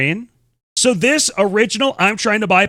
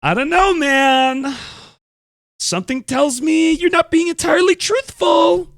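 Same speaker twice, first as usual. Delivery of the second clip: mock doubt; 10 semitones higher than usual. Streamer doubting the video maker's Reddit denial, mock-sceptical. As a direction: pitch it way up in playful disbelief.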